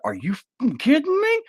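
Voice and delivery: high, loud shocked outburst; wife appalled at the grandpa's remark, high and loud; outraged disbelief. Retold or imitated. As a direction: shriek it in total disbelief. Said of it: "she's gonna be like".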